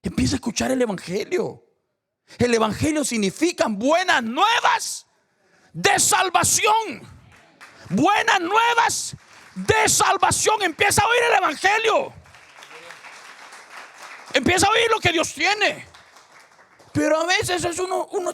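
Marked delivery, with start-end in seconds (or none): 2.4-7.0 loud, high, slow sermon call; preacher building the message; slow preaching proclamation. Acted. Preaching climax. 7.9-18.3 preacher shouting the good news; full-force preaching shout. Acted. Preaching climax.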